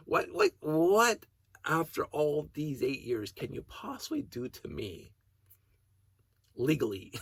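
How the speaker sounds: high, loud, drawn-out whats; incredulous at the threat after eight years; disbelieving. Real, not acted. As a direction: stretch each what in disbelief. Incredulous rant at neighbor.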